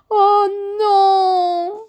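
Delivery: high, loud, drawn-out whimper; wailing moan. A narrator's reading. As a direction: moan as if all is ruined. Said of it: "gémit-il". (gémir, plainte aiguë)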